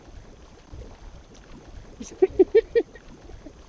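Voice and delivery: high breathy giggles rolling on; helpless ticklish laughter; high giggling fit. Acted. Woman tickled by hot tub jets, high giggling fit.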